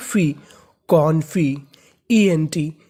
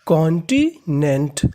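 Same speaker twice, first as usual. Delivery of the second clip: Continent drawn out slowly, each syllable separate; 3 semitones lower, much slower than usual. Shown as a demonstration. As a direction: spell out continent slowly for students. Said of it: "तो पूरी meaning मिला के पढ़ते हैं". (Continent को धीरे-धीरे, हर हिस्सा अलग बोलें)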